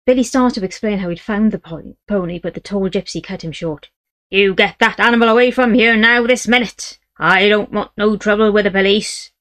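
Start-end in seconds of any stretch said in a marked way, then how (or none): 4.3-9.3 louder, higher angry orders; father drives his son's horse away. Acted. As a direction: shout it, furious and commanding.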